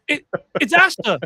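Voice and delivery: high, eager self-introduction; excited intro. Retold or imitated. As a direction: announce yourself with bright excitement. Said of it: "And she goes".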